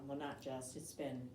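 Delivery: ordinary talk, nothing marked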